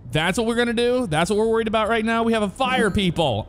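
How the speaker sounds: can't believe what the game asks during a fire; exasperated disbelief. Real, not acted. Gamer scolding the tutorial, exasperated.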